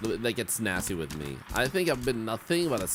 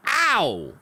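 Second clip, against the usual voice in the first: pained yelp; 9 semitones higher, 8 dB louder than usual. Real, not acted. Pain after finger hit.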